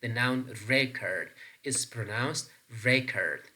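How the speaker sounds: "record" as REC-ord both times; "record" with a strong first syllable; stress on first syllable. Shown as a demonstration. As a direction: demonstrate the noun "record", hitting REC. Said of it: "stress pattern on the first syllable".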